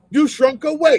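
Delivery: high, loud jeering retort; angry heckle. Real, not acted. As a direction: shout back at the screen. Heckling the speech.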